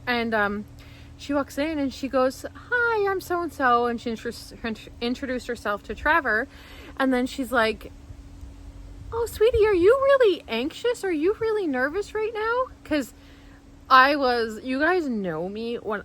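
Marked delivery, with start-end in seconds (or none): 2.5-3.9 high-pitched imitation of a friendly introduction; friendly nurse greeting. Nurse practitioner walks in, high friendly imitated greeting. 9.1-12.6 very high, cooing concern; sympathetic nurse. Retold or imitated. Nurse practitioner to anxious patient, high sympathetic imitation.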